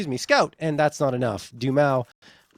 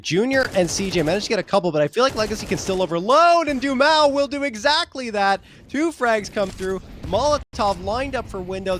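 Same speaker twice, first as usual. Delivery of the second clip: fast, raised, loud esports call; live caster riding a burst of action; excited play-by-play; 6 semitones higher, 7 dB louder than usual. Real, not acted. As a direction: call the action at full excitement, louder and higher.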